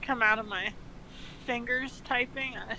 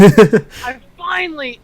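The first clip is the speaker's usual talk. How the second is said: mock realization; 2 semitones higher, 17 dB louder, slower than usual. Dramatic realization.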